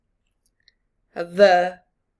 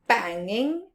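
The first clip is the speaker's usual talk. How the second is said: plain reading voice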